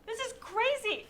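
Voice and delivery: laughing, high and slow; laughing disbelief. Acted. Laughs reading the order.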